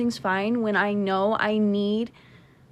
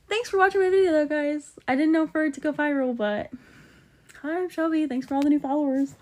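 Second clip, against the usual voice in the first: high, perky greeting to followers; cheerful high-pitched sign-off; bright thank-you; 5 semitones higher than usual. Real, not acted. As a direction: beam at the camera, voice up high.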